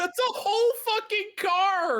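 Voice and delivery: high, slow shocked yell; shocked shout. Real, not acted. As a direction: cry out in disbelief. Shocked at caught car.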